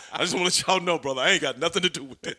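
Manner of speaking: imitating Sting. Wrestler retelling Sting's disclaimer, hammed-up impression. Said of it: "Sting go".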